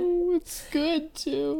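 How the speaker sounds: high, strained, close to tears; choked up. Real, not acted. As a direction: hold back tears. Moved by the poem.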